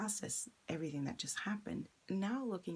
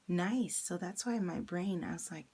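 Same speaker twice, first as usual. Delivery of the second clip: ordinary delivery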